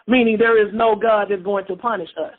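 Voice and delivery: normal talking voice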